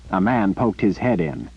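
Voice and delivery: plain reading voice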